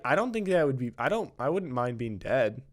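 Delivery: usual speaking voice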